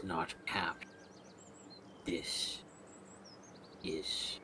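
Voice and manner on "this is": a soft, unvoiced, creeping whisper; menacing whisper. Snips hissing his name at Riolus from behind, whispered.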